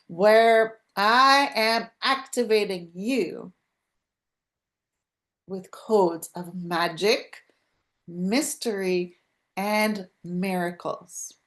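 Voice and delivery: unhurried, spacious intro delivery; slow podcast intro. Acted. Host opening her daily show, unhurried and spacious. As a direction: savour each word as a grand opening.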